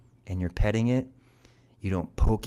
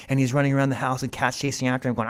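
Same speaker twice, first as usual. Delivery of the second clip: plain reading voice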